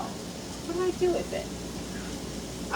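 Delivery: slow whisper while searching; whispered to self. Real, not acted. Searching for her selfie stand, whispering to herself. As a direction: murmur the question to yourself, unvoiced.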